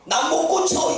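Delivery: high, exasperated refusal; king refusing. Retold or imitated. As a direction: throw it back like a flustered king. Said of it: "답이 뭐예요?".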